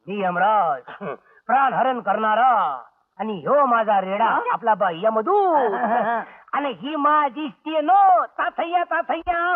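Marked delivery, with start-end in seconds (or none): 0.1-5.7 low, booming god of death; Yama the death god. Pretend. Actor presenting himself as Yama to scare the host, low voice. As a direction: announce yourself as Yama, deep and grand. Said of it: "नाटकाची referee करून आलोय".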